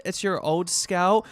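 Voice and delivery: ordinary talk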